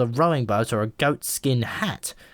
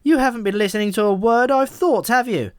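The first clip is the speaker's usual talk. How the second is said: loud and higher, still scolding; angry shout; 10 semitones higher, 5 dB louder than usual. A narrator's reading. Pigeon scolding Malcolm, loud exasperated shout. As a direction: keep yelling, fed up. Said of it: "it shouted".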